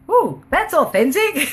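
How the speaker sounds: high, cooing mock admiration; mock-impressed reaction. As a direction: coo it wryly, about to burst out laughing. Her wry reaction to the yelling.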